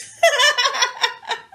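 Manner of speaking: high, loud delighted laugh; burst of high laughter. Real, not acted. Laughing after a shout-out to her baby, high delighted laugh. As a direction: let out a bright real laugh.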